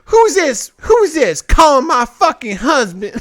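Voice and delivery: mocking a wife who thought he was a woman; jealous wife. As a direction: imitate a suspicious wife going off. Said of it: "And she's like".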